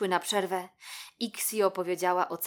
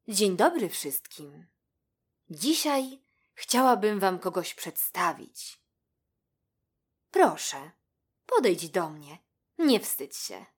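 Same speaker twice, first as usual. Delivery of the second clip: a hint of a kindly schoolteacher addressing her class; lightly voiced teacher; 5 dB louder than usual. A narrator's reading. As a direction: lightly play the spider professor introducing a newcomer. Teacher spider greets the class. (lekko zagrana pani nauczycielka)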